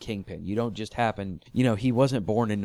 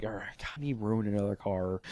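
grumbling kingpin; 2 semitones lower, 7 dB quieter than usual. Retold or imitated. Imitating the kingpin grumbling about his car. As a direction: mutter it like a fed-up crime boss.

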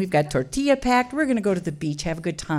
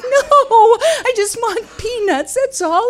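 much higher, louder plea; panicked pleading; 13 semitones higher, 6 dB louder, slower than usual. Retold or imitated. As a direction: frantically explain yourself. Pleading amid family chaos.

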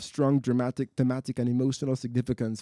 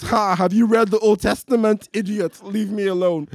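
mocking God; 8 semitones higher, 8 dB louder than usual. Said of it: "God says".

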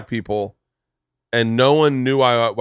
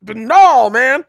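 high, loud, squealing protest getting louder; high mock outrage; 13 semitones higher, 8 dB louder, slower than usual. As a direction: play Kevin freaking out about the kiss, high and loud. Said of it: "He was like".

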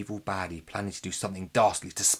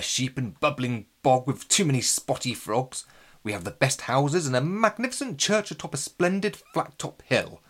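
pompous lord voice; 4 semitones higher, 7 dB louder than usual. Acted. Sir Bertie's pompous declaration.

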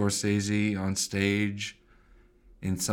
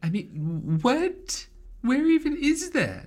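high, squeaky bafflement; mock outrage; slower than usual. Co-host hears Bismarck preferred to New York, mock incredulous. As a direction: squeal in pretend disbelief.

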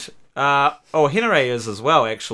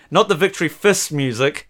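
ordinary talk, nothing marked

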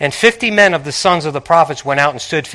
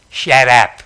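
blunt retort; 4 semitones lower than usual. Retold or imitated. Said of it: "Tony language".

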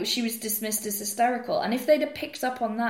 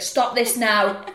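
put-on annoyed boyfriend; 7 dB louder, much slower than usual. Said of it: "He's like".

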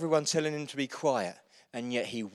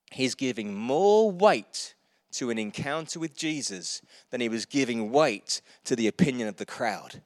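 ordinary talk

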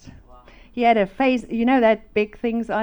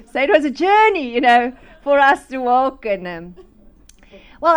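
normal talking voice

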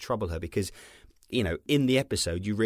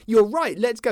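mock eager; 12 semitones higher, 8 dB louder than usual. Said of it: "you have not gone".

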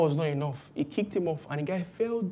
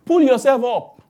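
much louder, higher push; loud advice; 7 semitones higher, 11 dB louder than usual. Retold or imitated. People telling the suffering believer to pull himself up, loudly. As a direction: bark it like a pep talk. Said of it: "Or they tell you".

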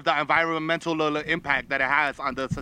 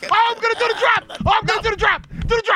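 high, loud frantic yelling; panicked yelling; 4 dB louder than usual. Acted. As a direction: scream it out in a panic. Character shouting in panic.

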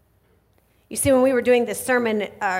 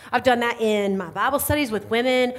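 ordinary talk, nothing marked

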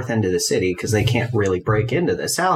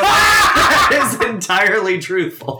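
laughing shout; 10 semitones higher, 6 dB louder than usual. Real, not acted. Laughing claim in zone of truth.

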